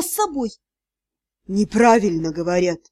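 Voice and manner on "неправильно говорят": deep, low creature voice; sea god. A narrator's reading. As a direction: correct her in a much lower voice. Sea god answers the drowning heroine. (низкий голос морского бога)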